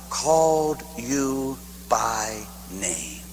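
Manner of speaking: very slow and hushed, stretched out; slow, tender. Acted. Preacher slowly voicing God calling each listener. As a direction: let each word linger softly. God's words drawn out.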